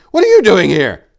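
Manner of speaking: loud, high, frightened demand; alarmed shout. A narrator's reading. Sentry shouting at the approaching figure in alarm. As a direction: yell it, careless of the noise. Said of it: "Carneath cried in alarm".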